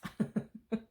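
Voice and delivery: high little laugh; short laugh. Real, not acted. Vlogger explaining why she wears pants, short high laugh.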